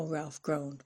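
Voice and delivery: ordinary delivery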